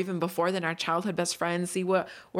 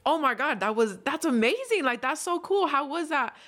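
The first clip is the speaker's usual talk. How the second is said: high, gushing excitement; excited; 8 semitones higher than usual. Retold or imitated. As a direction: gush like meeting a star. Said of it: "I was super excited".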